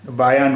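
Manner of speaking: बायान closed by a sounded n; full final n. Shown as a demonstration. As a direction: end बायान on the consonant n. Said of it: "फिर इसका उच्चारण".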